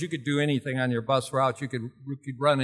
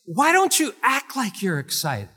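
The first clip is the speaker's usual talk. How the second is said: high, insistent teacher prodding; Marlene Evans; 9 semitones higher, 4 dB louder than usual. Retold or imitated. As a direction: imitate a teacher pushing a student to perk up. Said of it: "she liked to talk loud".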